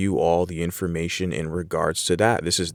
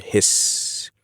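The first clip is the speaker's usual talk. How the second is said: hissed word. Shown as a demonstration. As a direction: stretch the S at the end into a hiss. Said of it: "The emphasis is on the two S's at the end".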